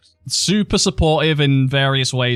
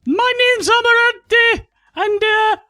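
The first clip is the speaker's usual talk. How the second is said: very high falsetto Geordie woman; Amaranth; 4 dB louder than usual. Pretend. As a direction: put on a squeaky-high Geordie hostess. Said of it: "Amaranth, how you doing?".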